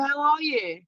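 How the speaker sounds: ordinary talk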